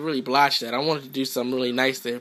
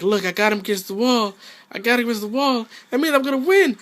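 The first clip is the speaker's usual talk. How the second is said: higher, smug gloating voice; mocking the opponent; 7 semitones higher, faster than usual. Gamer mocking opponent's premature gloat, higher smug voice. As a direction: gloat like you've already won. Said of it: "He was like".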